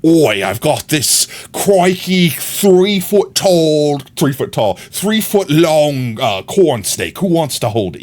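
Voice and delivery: imitating a Crocodile Dundee type Australian; Australian accent. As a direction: put on a drawn-out, hammed-up Australian accent. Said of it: "I'm trying to think, like, Australian accent".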